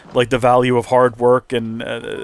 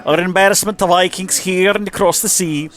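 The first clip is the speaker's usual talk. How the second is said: high, sneering bully reading his own letter; scornful Viking letter voice; 6 semitones higher than usual. Acted. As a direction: read it like a proud, mocking Viking thug. Reads rival's letter in a voice.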